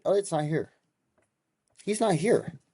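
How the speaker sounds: high, aggressive telling off. Retold or imitated. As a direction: angrily order him out. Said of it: "with a more aggressive tone than that".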